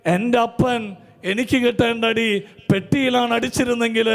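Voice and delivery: high, loud, fervent preaching cadence; full-force preaching. Acted. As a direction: preach it out to the whole hall with fire. (പൂർണ്ണ ആവേശത്തോടെ ഉച്ചത്തിലുള്ള പ്രസംഗം)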